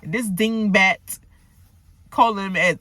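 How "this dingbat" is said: normal talking voice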